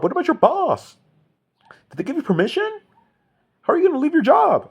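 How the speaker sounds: very high, loud mocking whine; mocking shrill nag. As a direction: squeal it like a horrified busybody. Escalating mockery of people.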